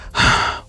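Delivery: audible exhaled sigh. Acted. Marinette before explaining to the principal, sighing. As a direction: let out a long breath. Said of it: "Marinette sighing".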